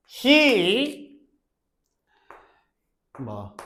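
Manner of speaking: slow dictation. Shown as a demonstration. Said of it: "거꾸로 연습 한번 해봐".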